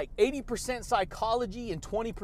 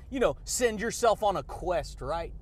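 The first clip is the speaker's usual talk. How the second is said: usual speaking voice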